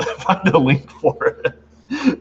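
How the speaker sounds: words squeezed out through laughter, slow; real laughter breaking in; laughing. Real, not acted. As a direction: giggle through the request. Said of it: "I should not be laughing this much".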